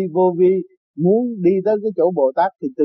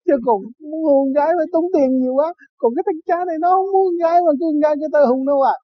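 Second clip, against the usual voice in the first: excited joking plea; 10 semitones higher than usual. Retold or imitated. Friend's joking plea to priest.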